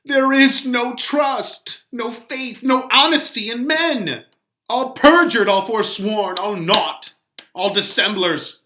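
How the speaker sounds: put-on high, loud lament of the old nurse; wailing nurse. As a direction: wail it out in grief like the nurse.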